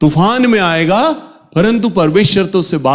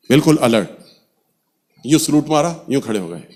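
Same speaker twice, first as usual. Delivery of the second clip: normal talking voice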